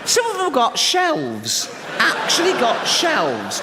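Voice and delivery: high, slow laughing disbelief; incredulous. Real, not acted. Telling the crowd about shelves, high slow disbelief. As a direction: marvel at it, half laughing. Amazed at fat passengers.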